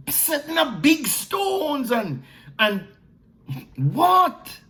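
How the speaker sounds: fervent pulpit challenge; impassioned preaching. Acted. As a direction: press the congregation hard. Preaching at full force.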